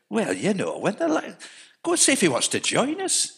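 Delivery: lightly put-on Geordie accent, pitched high; a rough try at a Newcastle voice; Geordie accent attempt. Mates from the Northeast urging their friend, in a Geordie accent. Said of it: "I can't do the accent".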